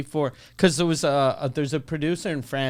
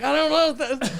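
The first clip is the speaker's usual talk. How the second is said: high, overwhelmed mock protest; flustered giving up; 9 semitones higher than usual. Door woman worn down by the gatecrasher, flustered. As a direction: throw it away high, in comic helplessness.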